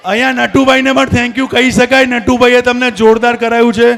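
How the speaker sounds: loud, projected prompting; calling over crowd. Real, not acted. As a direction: carry it over a busy hall.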